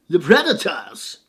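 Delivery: drawn-out, badly faked Austrian action-star voice; slow, hammed-up celebrity impression; Arnold Schwarzenegger. Host jokingly announces the hockey team, awful Arnold Schwarzenegger impression. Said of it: "such an awful Arnold Schwarzenegger impression".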